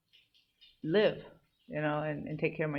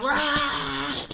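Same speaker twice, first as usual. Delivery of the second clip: high, held-back battle cry; sustained anime-style power-up "Ah!"; Japanese character power-up yell; 9 semitones higher than usual. Retold or imitated. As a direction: imitate a character charging up. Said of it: "like one of those Japanese characters".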